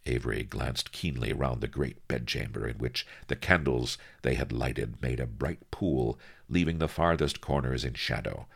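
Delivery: plain reading voice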